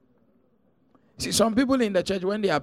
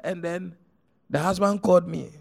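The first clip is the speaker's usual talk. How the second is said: normal talking voice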